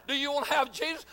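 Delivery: ordinary talk, nothing marked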